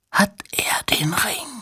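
wheezing, menacing whisper; strange wheezing voice. A narrator's reading. Stranger at Two-Tone's ear demanding an answer, wheezing. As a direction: wheeze it out softly, almost without voice. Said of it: "mit merkwürdig keuchender Stimme".

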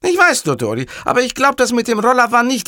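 high, loud, earnest underling; hapless caller Cattarella. Acted. Cattarella corrects the boss about the scooter, high and earnest. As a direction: protest in a high-pitched character voice.

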